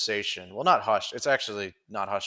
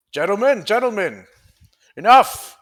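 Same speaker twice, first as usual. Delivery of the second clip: high, loud call for order; commanding shout; 12 semitones higher, 12 dB louder than usual. Acted. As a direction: bellow to stop the fight. Jasper breaking up brawl.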